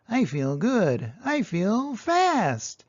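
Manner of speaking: slow, drawn-out, rising to a high "fast"; tortoise voice. A narrator's reading. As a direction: stretch it out, savoring the feeling. Tortoise waking in spring.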